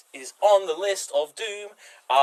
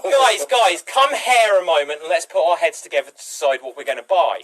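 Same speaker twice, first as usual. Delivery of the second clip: louder, higher rallying call; calling the group; 5 semitones higher, 8 dB louder than usual. Pretend. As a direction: gather everyone in.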